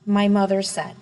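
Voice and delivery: ordinary delivery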